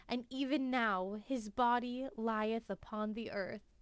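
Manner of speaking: usual speaking voice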